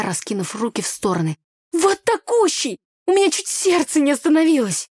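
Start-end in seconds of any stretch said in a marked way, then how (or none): 1.7-4.9 high, loud, breathless-with-fright exaggeration; agitated self-defence. A narrator's reading. Girl showing how big the snake was, agitated. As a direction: insist on it, pitched way up. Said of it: "В своё оправдание начала я".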